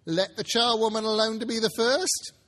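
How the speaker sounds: high, loud cackling charwoman; shrill old woman calling out; crying out. Acted. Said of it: "cried she who had entered first".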